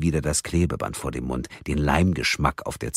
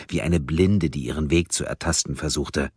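plain reading voice

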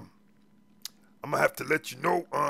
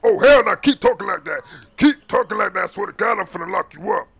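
explosive loud start, higher pitch; angry threat; 3 semitones higher, 8 dB louder than usual. Acted. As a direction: warn him loudly to stop talking. Officer threatens to lock him up.

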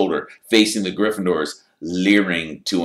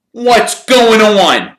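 loud, high, drawn-out question; displeased captain calling out; frowning demand; 15 dB louder, much slower than usual. A narrator's reading. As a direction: demand an explanation, slow and loud.

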